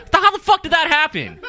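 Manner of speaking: loud, high-pitched disbelief; mock outrage. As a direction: shout it as an incredulous rant. Mock outrage at protest planning.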